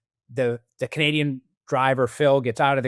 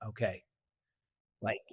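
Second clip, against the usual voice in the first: much softer, low, deadpan "Okay"; unconvinced okay; 4 semitones lower, 12 dB quieter than usual. Retold or imitated. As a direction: let the doubt sit in a quiet, low word. Mentor unconvinced by the answer.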